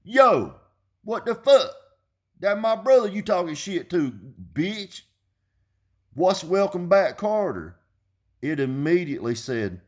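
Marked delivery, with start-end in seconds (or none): 0.0-7.8 high, loud put-on challenge; raised-pitch imitation of a pushy guy; confrontational street talker. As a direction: act out an aggressive caller demanding an answer. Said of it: "And then when I went".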